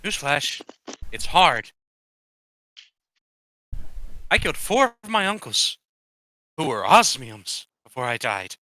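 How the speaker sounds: lightly acted speech to a crowd; lightly voiced Rosenforth. Ghost Rosenforth puts a question to the other ghosts, lightly acted. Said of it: "Rosenforth is like".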